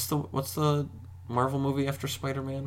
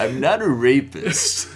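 a lightly put-on gruff hero, louder and slow; mock Batman; 4 semitones higher, 7 dB louder, slower than usual. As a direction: deliver it as a mock-serious vigilante. Joking about Don't Breathe Batman.

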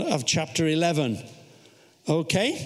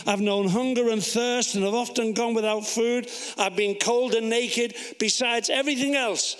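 raised, insistent list-reading voice; Scripture reading; 5 semitones higher than usual. Acted. Preacher reading out Paul's boast of hardships, insistent recital. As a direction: pile up each hardship on a high, level pitch.

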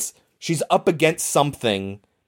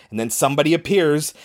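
ordinary talk

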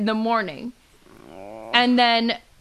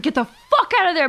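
raised, emphatic urging; urgent retold warning; 7 semitones higher, 4 dB louder than usual. Urging a buddy to get out, emphatic. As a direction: punch it up like pressing advice on a friend. Retells urging friend to leave.

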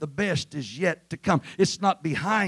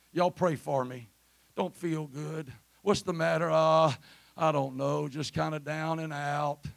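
mopey complainer; 5 dB quieter than usual. Acted. Churchgoer asking for prayer because they feel down and out, mopey. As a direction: play someone feeling sorry for themselves. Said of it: "They'll tell you how tough it is".

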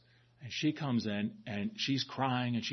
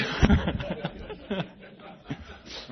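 breathy laugh at the story; real laughter after the punchline; 6 semitones higher, 3 dB louder than usual. Real, not acted.

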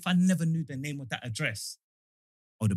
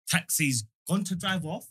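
usual speaking voice

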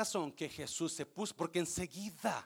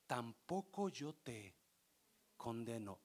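softer, lower and slow; tender forgiveness; 8 semitones lower, 11 dB quieter than usual. Acted. Jesus tells the woman he does not condemn her, soft and slow. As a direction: say it slowly, softly, full of mercy.

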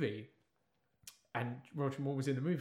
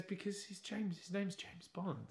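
put-on puzzled boy; 7 semitones higher, 8 dB quieter, slower than usual. Puzzled boy explains to his dad.

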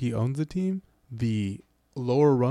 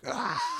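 high, restrained imitation scream; mock Wilhelm scream; 14 semitones higher, 6 dB quieter than usual. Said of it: "straight out Stormtrooper Wilhelm scream".

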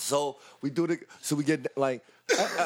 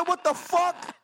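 much higher, rising disbelief; shocked outburst; much slower than usual. Retold or imitated. Teller retells hearing about the fiance, high and shocked. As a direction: fling it up in disbelief. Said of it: "I said".

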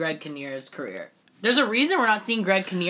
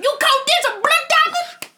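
high, loud hammed-up outburst; mock actor yelling; 7 dB louder than usual. Mocking Leo's Oscar clip, high over-the-top shout. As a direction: scream it like an overacting Oscar nominee. Imitating Leo in Blood Diamond.

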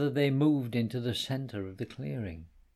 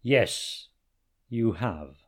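lion voice; 2 semitones lower, 4 dB louder, much slower than usual. A narrator's reading. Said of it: "said a voice from behind".

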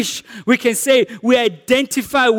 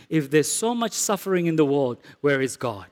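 quoted softly, lower and quieter; hushed doubting question; 7 semitones lower, 7 dB quieter than usual. Retold or imitated. Preacher voicing a doubter to the congregation, hushed. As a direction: murmur the doubter's question under your breath. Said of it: "a statement like this".

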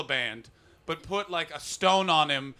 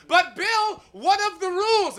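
much higher, louder fussy protest; prim worrier; 14 semitones higher, 7 dB louder than usual. Retold or imitated. Al Gore fretting to Bill Clinton, high and prissy. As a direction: fret like a prissy sidekick. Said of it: "It's just like".

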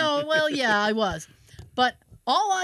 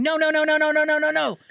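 retold yelling; 3 semitones higher, 5 dB louder, faster than usual. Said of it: "she kept screaming and yelling".

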